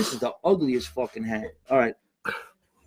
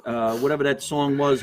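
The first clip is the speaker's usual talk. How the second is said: ordinary talk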